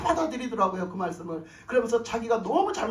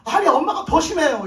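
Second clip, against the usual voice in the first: alarmed cry; 5 semitones higher, 9 dB louder than usual. Retold or imitated. Alarmed phone call retold.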